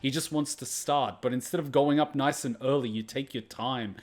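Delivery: ordinary talk, nothing marked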